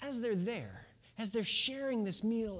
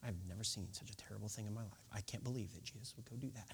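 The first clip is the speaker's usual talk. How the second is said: much softer, lower, fast grumbling; muttering crowd; 10 semitones lower, 13 dB quieter, faster than usual. Retold or imitated. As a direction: gossip it under your breath to a neighbour. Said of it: "talking out of the side of their mouth".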